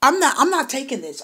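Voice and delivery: a hammed-up "you know what" anger; mock angry outburst. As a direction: play the fed-up client losing patience. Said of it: "I am angry with you".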